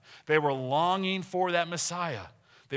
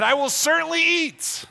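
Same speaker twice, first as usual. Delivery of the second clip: much higher and louder, certainly eat bellowed; comic greedy vow; 12 semitones higher, 9 dB louder than usual. Pretend. Preacher joking about cookie binges, loud mock vow. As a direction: proclaim it like a glutton's oath. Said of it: "when I go to Golden Corral".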